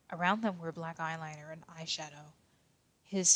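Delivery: ordinary delivery, nothing marked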